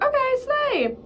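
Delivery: high sing-song mock of a friend; upbeat agreement. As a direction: chirp it way up high. Friend agrees to come along.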